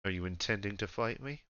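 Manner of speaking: lightly voiced ruler, trailing softly; understated regal menace; a measured Emperor sizing up a subject; imposing Emperor. Game master plays the Emperor.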